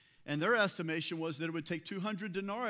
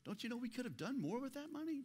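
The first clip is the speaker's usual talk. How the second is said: soft, higher, quick little objection; mild mock complaint; 6 semitones higher, 8 dB quieter, faster than usual. Preacher voicing a too-mild objection to the woman, softly complaining. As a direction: play a timid, half-hearted reproach under your breath. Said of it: "So it wasn't just a".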